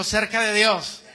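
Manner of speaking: slow, loud "cerca de Dios" with the weight on "Dios"; loud slow call. Shown as a demonstration. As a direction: call it out slowly for the church to repeat. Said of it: "Diga conmigo".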